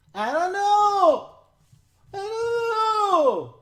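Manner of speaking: high, loud, slow wailing "I don't know"; whimpering daughter. Retold or imitated. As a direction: wail it like a scared daughter.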